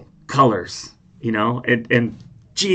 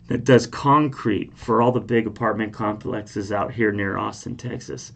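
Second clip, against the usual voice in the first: usual speaking voice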